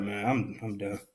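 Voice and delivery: normal talking voice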